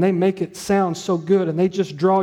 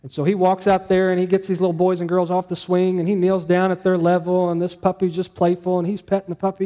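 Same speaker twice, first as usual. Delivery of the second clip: normal talking voice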